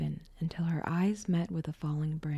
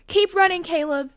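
high, loud urgent scream; 13 semitones higher, 12 dB louder than usual. A narrator's reading. Janet urging Caleb onward, screaming. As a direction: yell it urgently to someone ahead. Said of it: "Janet screamed".

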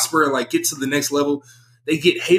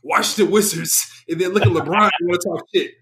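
ordinary talk

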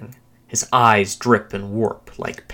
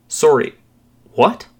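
higher, louder baffled question; confused reply; 6 semitones higher, 6 dB louder than usual. A narrator's reading. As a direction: blurt it out, pitch climbing on the question. Narrator voices own stunned reply.

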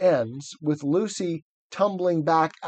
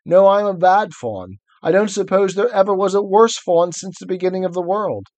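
lightly voiced faun, higher and louder; guilty faun; 5 semitones higher, 4 dB louder than usual. Faun confessing his badness to Lucy, lightly voiced. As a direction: wail your own wickedness. Plays Tumnus reading aloud.

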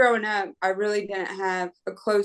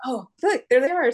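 mock call out; 5 semitones higher, 4 dB louder, faster than usual. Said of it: "to yell".

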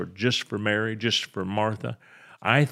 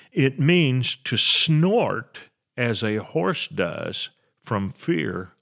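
ordinary delivery

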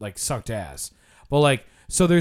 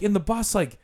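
usual speaking voice

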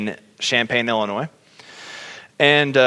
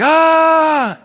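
a sudden high piercing mock scream; loud high imitated yell; 8 dB louder than usual. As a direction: shriek out loud like a stranger in the crowd. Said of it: "just screaming".

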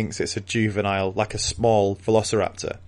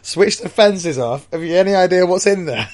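incredulous worker; 9 semitones higher, 6 dB louder than usual. Pretend. Acting scared worker reply.

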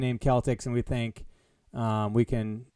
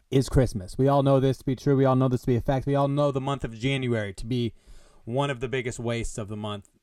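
ordinary delivery

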